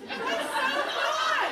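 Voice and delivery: high, loud, drawn-out squeal of delight. Real, not acted. Hostess squealing with delight at the toy for the party guests. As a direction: squeal it with a laugh, thrilled.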